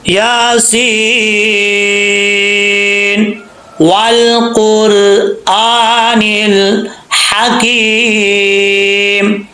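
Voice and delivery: slow, drawn-out chanted recitation; tajweed recitation; Quranic recitation. Shown as a demonstration. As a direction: recite each word long and measured. Teacher recites verses.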